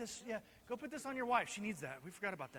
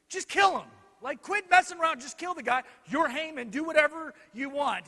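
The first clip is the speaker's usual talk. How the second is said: loud, higher, emphatic nudge; exasperated urging; 5 semitones higher, 7 dB louder than usual. Acted. Wife urging her husband to act, exasperated. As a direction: push him to just get on with it.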